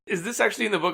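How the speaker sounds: ordinary delivery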